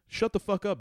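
loud, high interruption; exasperated cut-off. Acted. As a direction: cut the speaker off, fed up. Cuts off imagined political speech.

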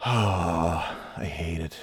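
low, soft, dragged-out exhale; defeated sigh. Real, not acted. Podcaster giving up on the computer, defeated. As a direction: sigh it out slowly, worn down. Sighing after cursing the computer.

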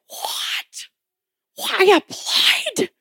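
choked, crying-laughing surprise; stunned disbelief. Retold or imitated. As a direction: gasp it out half in tears. Shocked by college phone call.

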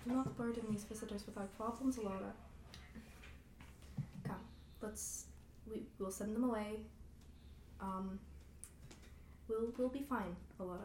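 put-on hushed, halting old father. Weary father tells daughter to send visitors away, very quiet. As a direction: speak softly, with long pauses. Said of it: "and much quieter, Sylvar says".

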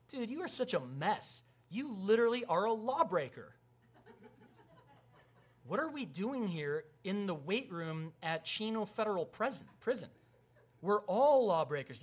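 high, loud comic outrage; hammed-up incredulous protest; mock indignation. Acted quarrel between prisoners.

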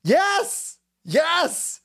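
high, excited mock cheer. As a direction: yelp out the yes like a fan.

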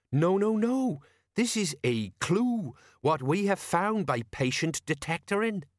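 self-important sergeant, correcting; high, emphatic, pompous character voice; Colon. Acted. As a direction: announce it like a proud detective.

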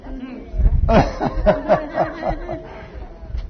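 a high laugh at his own joke; preacher laughing at his own punchline. Real, not acted. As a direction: burst into a high chuckle.

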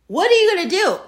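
high, pleading repeat of the question; exasperated. Real, not acted. Asking viewers in disbelief, high and exasperated.

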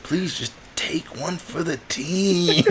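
high, slow, stretched-out plea; hammed-up begging, dragging out the final word; mock begging. Cowed kid asks Caleb to play along, mock begging. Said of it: "Please, I'm begging".

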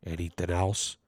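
"Anything else?" said very low and very soft; real quiet. Retold or imitated. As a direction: mumble "Anything else?" under your breath. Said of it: "real quiet".

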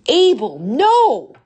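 high, loud desperate cry. A narrator's reading. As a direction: scream his name to stop him.

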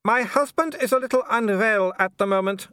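high, sweet wifely voice with menace beneath; a wife covering for her husband in public; special wife voice. A narrator's reading. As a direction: sound polite while promising trouble later. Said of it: "in the special wife voice".

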